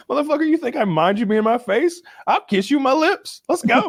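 high, mock macho taunt; cocky fighter. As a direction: taunt him like a fighter at the weigh-in. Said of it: "Just like".

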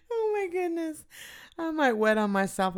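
high giggling through words; breathless with laughter; laughing hard. Real, not acted. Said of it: "I better stop".

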